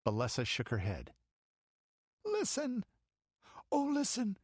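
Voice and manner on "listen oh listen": put-on high, whimpering girl's plea; cling and whimper in fear; whimpering child. Frightened child clinging to Balessa, whimpering. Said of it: "whimpered the child".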